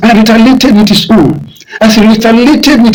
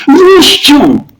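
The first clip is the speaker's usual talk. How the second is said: a battle cry, pitched up; shouted name; 6 semitones higher than usual. A narrator's reading. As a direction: shout the name to stop the fight. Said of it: "He shouted".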